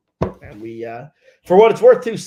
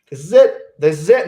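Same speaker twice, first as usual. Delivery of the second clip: panicked realization; 11 dB louder than usual. Retold or imitated. Panicked inner thought near barrier.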